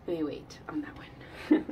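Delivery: whispering. Real, not acted. Hesitant aside before laughing.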